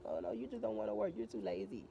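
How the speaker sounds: mocking scold voice.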